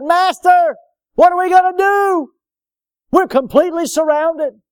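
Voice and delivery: high, loud, frantic cry to his master; the terrified servant bursting in to his master; high-pitched panic, shouting for help; panicked servant. Acted. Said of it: "He's panicking".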